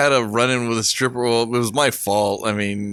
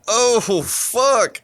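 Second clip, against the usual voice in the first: higher, drawn-out groan of dismay; mock-appalled swear, pitched up; retold disgust; 9 semitones higher than usual. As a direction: act out recoiling from a bad smell. Recoils from armpit smell.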